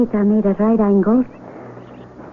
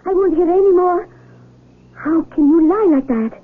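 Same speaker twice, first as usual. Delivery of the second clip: angry outburst; 6 semitones higher than usual. Acted. Blind girl refusing Carlos's talk of sky, angry. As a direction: pitch climbing high as she refuses to listen. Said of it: "made-up words that make me so angry".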